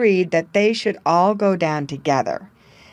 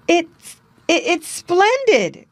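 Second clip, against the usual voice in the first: excited stammer; 12 semitones higher, 3 dB louder than usual. A narrator's reading. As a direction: stumble over it, amazed. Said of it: "cried Jerry".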